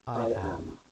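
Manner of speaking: a quiet, proud I am. A narrator's reading. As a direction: answer with pride, understated. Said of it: "he says proudly".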